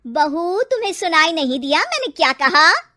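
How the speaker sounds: stern scolding. Acted. Mother-in-law repeats her order.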